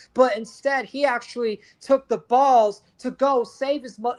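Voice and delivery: ordinary talk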